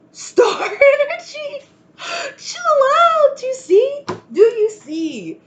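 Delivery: real crying laughter at the card; laughing disbelief. Real, not acted. Reader laughing as the star card matches, real laughter.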